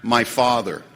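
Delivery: low, measured, with weighty pauses; reading written inventory. Acted. Speaker reading out his resentment at his father, low and deliberate. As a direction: read it out starkly, slowing and dropping to the end. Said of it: "This is how much I wrote".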